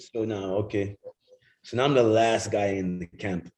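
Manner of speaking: normal talking voice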